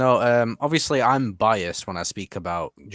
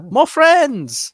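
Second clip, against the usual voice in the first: excited; 9 dB louder than usual. Real, not acted. Excited over new listener.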